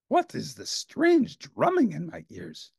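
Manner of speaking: startled awake and bewildered; Grover, puzzled. A narrator's reading. Farmer Grover woken by drumming, slow and high. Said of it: "he cried".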